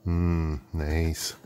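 ordinary delivery

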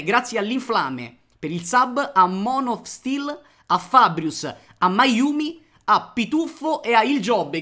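loud, high, rapid thank-you roll call; streamer shout-outs. Real, not acted. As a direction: belt out the sub thanks to chat. Reads out sub thanks.

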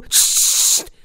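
sharp unvoiced shush; loud hiss shush. A narrator's reading. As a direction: hush him urgently. Shushing his friend.

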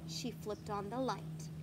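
plain reading voice

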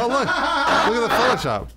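laughing. Real, not acted. Laughing at meme image.

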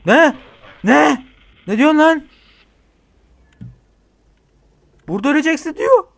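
alarmed disbelief; panicked shock. Real, not acted. Frightened player reacts to a death threat.

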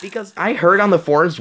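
ordinary talk

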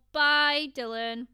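ordinary talk

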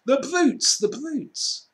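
Lucy weeping at the cruel creatures; tearful outrage. A narrator's reading. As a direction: cry out in grief. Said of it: "tears streaming down her cheeks".

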